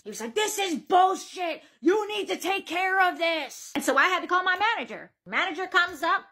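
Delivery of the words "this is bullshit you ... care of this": slow, drawn-out mock outrage; mock angry shout. Angry customer demanding help from the worker, mock shouting. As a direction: imitate a raging customer, stretching every word. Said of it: "This man was getting loud".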